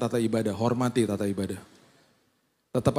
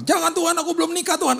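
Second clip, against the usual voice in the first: much higher, loud protesting yell; yell from the back; 4 dB louder than usual. Retold or imitated. Unmarried woman yelling back at the prayer in panic. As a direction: shout it in a panic from the back row. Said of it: "ada yang teriak di belakang".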